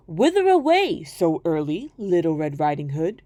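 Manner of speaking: low, sly wolf voice greeting his prey; a wolf sizing up a child. Acted. Wolf questions girl.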